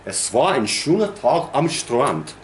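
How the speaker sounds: said in German, lifted in pitch; German example, evenly spread out; German sentence. Shown as a demonstration. Teacher gives class the German equivalent, in German. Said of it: "the equivalent in German ... is this".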